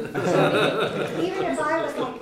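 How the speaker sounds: slow, laughing through the words. Real, not acted. Laughing as she tells the group about her moods. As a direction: caught up in a laugh.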